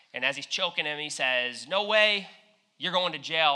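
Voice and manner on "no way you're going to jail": louder and higher, flat-out refusal; acted servant, heated and loud; angry refusal. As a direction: slam the door on his plea.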